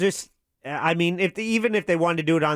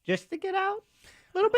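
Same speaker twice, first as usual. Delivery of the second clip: high squeaky question. Pretend. Silly garden hose idea.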